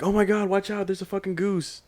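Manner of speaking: high-pitched panicked warning, retold; mock alarm. Fraternity members warn each other about a goose, mock alarm. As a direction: imitate a startled crowd crying out.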